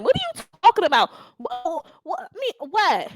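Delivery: high, rapid disbelief; incredulous. Real, not acted. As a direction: sputter like you can't believe it. Rants disbelieving at streamer.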